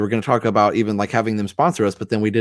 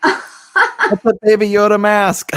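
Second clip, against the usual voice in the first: laughing through the words, slow and high; real laughter at a funny gift; 11 semitones higher, 6 dB louder, much slower than usual. Real, not acted. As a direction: giggle it out, delighted.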